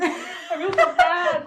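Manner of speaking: high loud slow laughing whine; laughing self-pity. Retold or imitated. Retelling the climb, loud slow laughing whine. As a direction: laugh through mock misery. Exhausted on the stairs.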